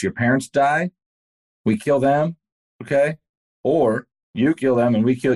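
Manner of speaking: understated impression of a militia leader's cold ultimatum; militia threat. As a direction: retell the killers' ultimatum with a hint of menace. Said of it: "lined up their families and said".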